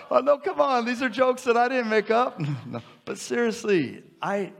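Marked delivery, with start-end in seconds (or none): 0.1-2.4 mock protest. Mock protest at own joke.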